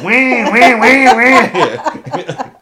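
mock Kawasaki engine whine; laughing high engine whine. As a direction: whine like a sport bike, laughing. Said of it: "make the sound".

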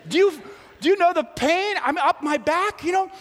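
high, incredulous you're sorry; outraged at a weak apology; mock-offended inner rant; indignant disbelief. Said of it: "And I thought".

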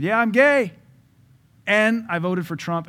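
imitating a loud, high-pitched announcement; bold mock declaration. Voices player's bold public announcement.

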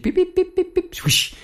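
very high little bird chirps; finch chirps. Retold or imitated. Telling about the escaped finch, very high chirping beeps. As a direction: chirp like a tiny zebra finch. Imitating finch, then net swish.

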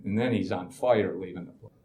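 ordinary delivery

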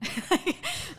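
short high burst of laughter; real laugh. Real, not acted. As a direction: laugh out loud at the absurdity. Laughs after retold quote.

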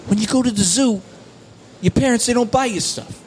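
high, punctuated comedian caricature; Sebastian Maniscalco. Acted. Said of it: "Sebastian Maniscalco".

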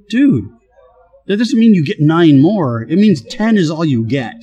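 high-pitched, incredulous reproach; exasperated, pitched well above normal; retold exasperated reproach. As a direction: act out setting a friend straight. Boss exasperated at employee missing recital.

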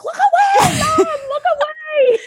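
high laughing cry to mom; laughing high plea. Retold or imitated. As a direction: squeal it out while laughing. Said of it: "I was like".

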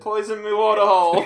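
hammy outback bloke; Australian accent. Pretend. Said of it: "Australian accent".

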